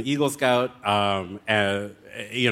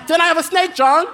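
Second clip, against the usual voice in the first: much higher and louder, exasperated "What am I gonna do with a snake?"; exasperated outburst; 7 dB louder, faster than usual. Real, not acted. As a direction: throw up your hands in loud disbelief. Exasperated question to host John.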